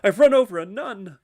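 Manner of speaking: high, loud panicked wail; hammed-up horrified shout for a punchline; screaming. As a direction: scream it out in mock horror. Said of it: "He runs out of the bar screaming".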